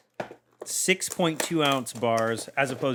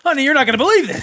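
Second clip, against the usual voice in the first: dramatic housewife; 13 semitones higher, 11 dB louder than usual. Pretend. Mock dramatic spouse announcement.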